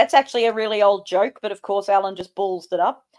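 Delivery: ordinary talk, nothing marked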